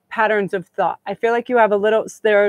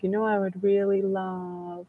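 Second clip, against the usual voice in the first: put-on much softer, slow hypnotic coax to the kids; mock hypnotic coaxing; 9 dB quieter, slower than usual. Shown as a demonstration. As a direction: lull them with a slow soft voice. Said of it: "I try little hypnotic things".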